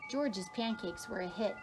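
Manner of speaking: ordinary delivery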